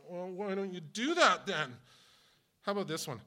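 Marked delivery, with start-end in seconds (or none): 0.1-1.8 much higher and louder, a mock comeback; sharp retort. Pastor snaps back at a rude critic, mock loud retort.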